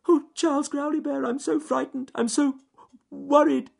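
very high, loud squeaky rabbit voice; worried little bunny, piercingly high; frightened rabbit. A narrator's reading. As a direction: confess your fright in a tiny squealing voice. Frightened rabbit confesses his worry.

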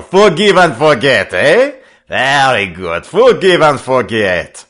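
high, loud French-accented skipper, hammed up; French Pete, slyly conciliatory. French skipper pretending to make up with the boy, slyly jovial. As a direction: laugh it off with oily, theatrical good humour.

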